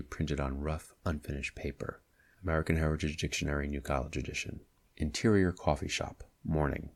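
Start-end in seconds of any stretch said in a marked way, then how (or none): 2.4-4.6 fast aside after the definition; tossed-off quick reference; rushed citation. Acted. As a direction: rattle off the source quickly. Reads dictionary citation.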